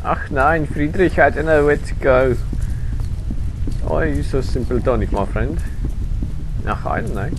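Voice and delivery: put-on hammy German accent. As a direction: play a comic German, light.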